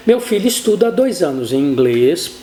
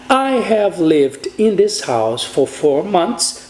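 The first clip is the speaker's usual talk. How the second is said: slow model English; much slower than usual. Shown as a demonstration. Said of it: "a mesma construção".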